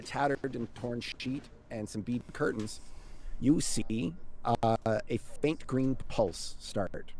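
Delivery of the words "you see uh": usual speaking voice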